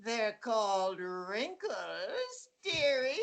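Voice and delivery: slow, crackly crone taunt. Retold or imitated. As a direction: drawl it like a hag. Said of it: "my parody of it in my mind".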